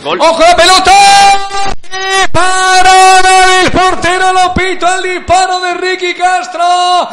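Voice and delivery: high, loud, urgent call; excited shout. Real, not acted. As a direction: shout the save. Save called live.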